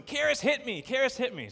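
put-on high, plaintive toddler voice calling his dad; whiny little boy. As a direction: play the three-year-old tattling on his sister. Son repeats complaint.

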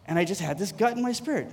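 normal talking voice

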